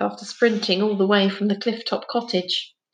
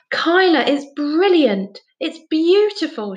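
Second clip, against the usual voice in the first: high, louder burst of delighted admiration; amazed; 8 semitones higher, 4 dB louder, slower than usual. A narrator's reading. Jazz admiring Kyla's dolphin picture, amazed and delighted. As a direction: gush with wonder, drawing out the praise. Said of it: "Jazz stared in amazement".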